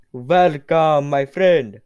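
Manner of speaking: loud, hearty character greeting; grand welcome. Acted. Rulers greet the returning challenger, loud and grand. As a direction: boom out a showy welcome.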